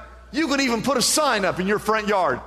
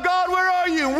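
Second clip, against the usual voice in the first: much higher, louder plea to God; crying out; 13 semitones higher, 3 dB louder than usual. Retold or imitated. Believer in trouble crying out to God, much higher. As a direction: call up to God like someone desperate. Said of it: "we cry out".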